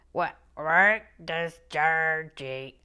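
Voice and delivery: slow, loud Northern Irish question; Northern Irish accent. Retold or imitated. Quoting Noreen's question in a slow, loud Northern Irish accent. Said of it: "borderline offensive".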